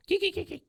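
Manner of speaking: very high imitated bed squeaks; imitating a squeaky bed in dolphin chirps. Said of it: "it sounds like a dolphin".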